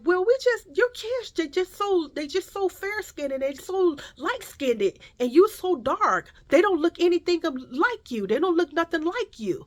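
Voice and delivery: high, halting, repetitive voice; imitated second woman. Second shopper explaining her curiosity to a mother, imitated haltingly. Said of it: "she was like".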